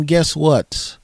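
ordinary talk, nothing marked

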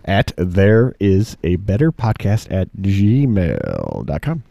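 drawn-out emphasis on every word; slow emphatic email address. Real, not acted. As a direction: stretch out "there is a better podcast at gmail" slowly, leaning on "better". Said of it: "I put so much emphasis on that".

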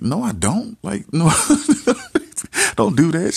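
high, laughing retold refusal; loud, high protest breaking into laughter; mock panicked protest.

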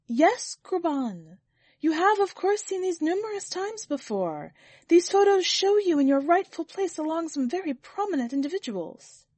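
high-pitched, flustered reassurance; distressed servant. A narrator's reading. Servant answering his master's question, high and distressed. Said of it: "distressed and at a loss".

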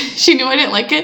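normal talking voice

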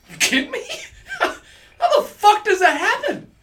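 laughing. Real, not acted. Laughing in disbelief at vinyl.

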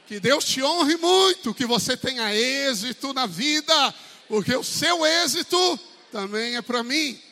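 loud, slow, high preaching blessing; exhorting the church to bless each other; fervent blessing. Acted. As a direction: pronounce it over the congregation. Leads congregation blessing.

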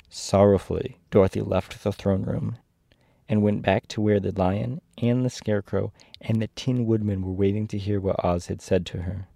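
plain reading voice

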